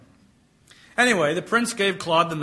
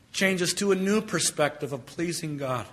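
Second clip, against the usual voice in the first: ordinary delivery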